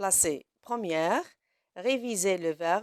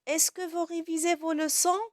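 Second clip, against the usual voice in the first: higher, rising on leçons; "Est-ce que vous révisez vos leçons?" asked higher with a rise on "leçons"; question tune; 6 semitones higher, 3 dB louder than usual. Shown as a demonstration. Teacher demonstrating the question intonation to pupils. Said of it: "Mais quand je dis".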